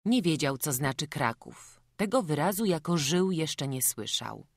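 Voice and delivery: ordinary delivery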